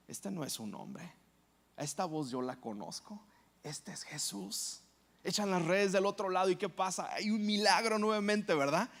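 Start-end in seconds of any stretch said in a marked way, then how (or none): 0.1-4.8 soft and hushed, slowing at the end; moved, hushed. Acted. Pedro recognizing Jesus from the boat, hushed and moved. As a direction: awestruck recognition, almost to yourself. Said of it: "Pedro se emociona".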